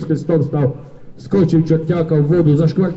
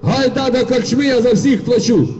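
cossack calling out; 8 semitones higher than usual. Acted. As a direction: call the whole street to the tavern. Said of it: "а козак до людей гукає".

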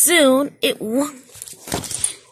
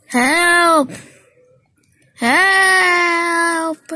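loud, high shouts for help; crying for help; 5 semitones higher, 6 dB louder than usual. A narrator's reading. Engine shouting for help in trouble. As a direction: yell it out desperately. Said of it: "he cried".

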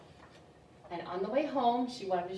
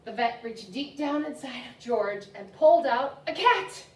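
slow build, climbing higher and louder to the last word; building reveal; 5 semitones higher, 11 dB louder, slower than usual. A narrator's reading. Librarian revealing the animal to kids, excited build. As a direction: stretch the suspense, then burst out.

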